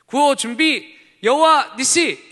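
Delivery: high, slow shouted command; rally call shout. Retold or imitated. Ministry leader calling the group to the cheer, shouting. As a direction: bark it out to the crowd. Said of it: "대표들이 나와서".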